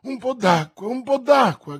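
high, loud cry for water; urgent shout. A narrator's reading. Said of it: "gridava D'Artagnan".

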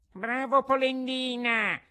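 very high, tiny voice; little mystery voice crying out. A narrator's reading. Hidden little voice teases Geppetto, high tiny cry. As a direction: taunt in a high, drawn-out squeak. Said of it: "gridò la solita vocina".

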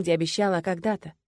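usual speaking voice

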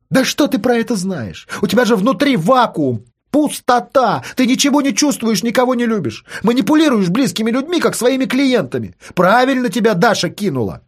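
high, heated accusing rant; angry teenage sister. Acted. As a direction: lash out at him. Sister attacks her brother.

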